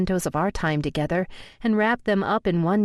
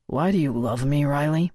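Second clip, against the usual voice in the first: lower, earnest male voice; lover's male voice; 3 semitones lower than usual. A narrator's reading. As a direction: speak low and sincerely to her. Narrator voices Colton.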